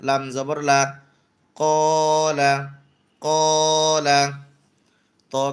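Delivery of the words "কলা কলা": louder, slow blended word; emphatic demo. Shown as a demonstration. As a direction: call the joined word out for the class. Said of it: "কফ খালি আলিফ জবর ক".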